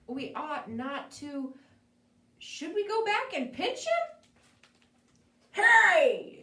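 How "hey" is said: much louder, higher gruff call; bear's shout. Acted. Bear confronting the creature, loud hey. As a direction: bark it out to stop them. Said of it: "said the bear".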